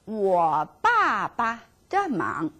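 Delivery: 我爸爸的忙 spelled out slowly; slow model. Shown as a demonstration. Teacher models 我爸爸的忙 slowly, word by word. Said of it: "我帮".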